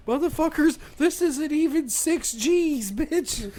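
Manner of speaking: high, drawn-out exasperated jeer; mock scolding. Operator scoffing at the crying kid, mock scolding.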